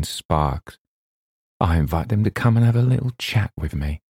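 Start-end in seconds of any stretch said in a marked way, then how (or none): none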